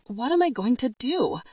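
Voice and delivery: rising into a mild wail. A narrator's reading. Weeping girl clinging to the priest, mild wail. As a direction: let it climb into a tearful cry. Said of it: "my voice rose to a wail".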